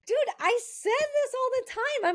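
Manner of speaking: laughing. Real, not acted. Laughing, high voice.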